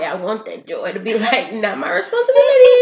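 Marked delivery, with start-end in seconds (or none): 1.5-2.8 laughing it off, high and loud; gleefully shrugging off duty through a laugh. Pretend. To friend about giving the baby back, laughing. Joking about carefree grandparent joy.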